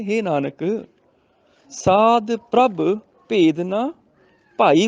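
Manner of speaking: long stop on Nanak, then slow spaced phrases; pause after Nanak. Shown as a demonstration. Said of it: "ਇੱਥੇ ਨਾਨਕ ਤੇ ਰੁਕਣਾ".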